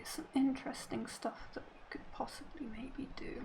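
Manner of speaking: murmured scheming aside; whispering. Real, not acted. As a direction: whisper it to yourself. Plotting moves aside.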